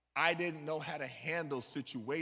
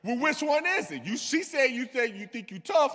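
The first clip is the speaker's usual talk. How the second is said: loud, higher, badgering demand; interrogating inmate; 6 semitones higher, 7 dB louder than usual. Older inmate loudly pressing the boy for an answer. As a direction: corner him, press him hard.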